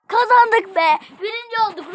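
loud, high victory shout; excited cheer. Real, not acted. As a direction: yell it out, thrilled you won. Celebrating a win in game.

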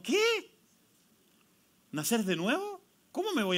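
high incredulous "¿qué?"; a high mock-baffled "¿qué?"; bewildered squeak. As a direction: squeak it like Nicodemo can't believe it.

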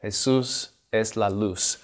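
"Jesús es la luz" in slow Spanish, Jesús said hay-SOOS; Spanish verse. Shown as a demonstration. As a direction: read out "Jesús es la luz" slowly, with Spanish vowels. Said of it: "taking a simple verse from Spanish".

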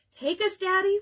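put-on high, loud childish plea; children shouting. A narrator's reading. Children shouting to Dad for sodas, high and loud. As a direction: call out eagerly to Daddy. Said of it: "we shouted".

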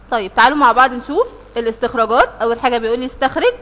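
ordinary talk, nothing marked

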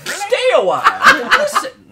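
high, laughing old man impression; Deckard Cain voice. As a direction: wheeze out an old sage's greeting, laughing. Quoting Deckard Cain's catchphrase.

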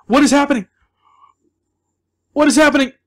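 loud, high cry of panicked confusion; alarmed. Real, not acted. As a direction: call out in real alarm. Panicking at sudden game event.

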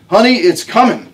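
put-on high, loud cry that the baby is coming; excited whoop. A narrator's reading. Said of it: "Harmony let out a whoop".

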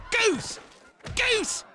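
high gleeful game call; excited shout. Acted.

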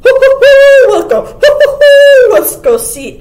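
high, very loud hooting; long hoots between the words; questioning owl. A narrator's reading. Owl urging the animals to go see, hooting loudly. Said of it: "asked the owl of many questions".

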